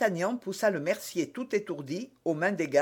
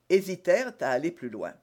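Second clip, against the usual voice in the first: ordinary delivery, nothing marked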